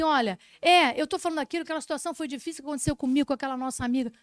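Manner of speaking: imitated confiding to a friend. Psychologist acting out venting to a friend, loud and rushed. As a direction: spill it to a friend in a rush. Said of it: "Mas pensar assim".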